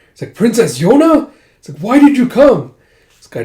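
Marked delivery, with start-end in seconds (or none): 0.4-1.3 high, loud startled question; astonished, voice leaping up; shocked cry. Acted. Startled that princess appears. 1.8-2.7 high, loud, distressed question; dismayed cry. Acted. Dismayed at princess's arrival.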